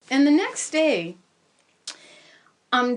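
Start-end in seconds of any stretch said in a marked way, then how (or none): none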